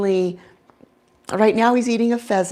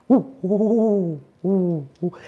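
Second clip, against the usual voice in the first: owl hoots; slower than usual. Shown as a demonstration. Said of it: "how I communicate with him".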